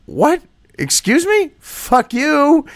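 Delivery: high, outraged what excuse me; mock offended people snapping back; indignant outburst. People answering the smug politicians, high and outraged. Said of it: "And the people are like".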